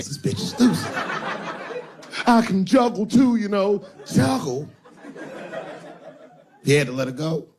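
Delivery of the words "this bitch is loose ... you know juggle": loose bottom bitch. Acted. Bottom bitch bragging to her pimp, high laughing squeal. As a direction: cackle it out like she's lost it. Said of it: "She saw the same".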